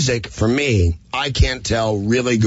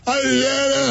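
much higher, mock drunk babble; drunk heckler; slower than usual. Comic mimics the drunk audience member greeting him, high drunken babble. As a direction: imitate a drunk guy's high-pitched greeting. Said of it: "there's a drunk guy named Mario".

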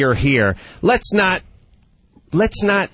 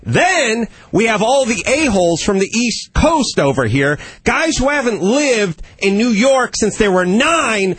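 fired-up rant; 6 semitones higher than usual. Real, not acted. Ranting about East Coast transplants.

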